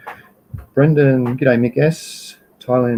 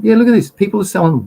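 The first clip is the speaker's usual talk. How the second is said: excited; 9 semitones higher, faster than usual. Real, not acted. Showing a surprising product.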